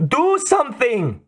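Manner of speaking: put-on high desperate Russian-accented plea; pleading. A narrator's reading. Trapped woman begging Bond, high frantic Russian-accented plea. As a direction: beg him to act, frantic. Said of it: "the woman was pleading".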